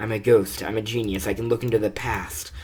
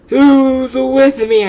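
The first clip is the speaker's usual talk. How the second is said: high, loud rallying cry; rallying shout; 13 semitones higher, 12 dB louder, much slower than usual. Acted. Leader shouting to followers at a march, loud and high. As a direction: whip the crowd up with a shout.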